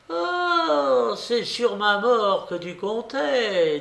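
very high, drawn-out accusation; indignant babouchka. A narrator's reading. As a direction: accuse him slowly in a high old-lady voice. Babouchka confronts the general. (accusation indignée de babouchka)

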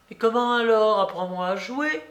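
put-on high, challenging old-lady voice; imperious old babouchka. A narrator's reading. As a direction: demand an answer in a high character voice. (vieille dame impérieuse)

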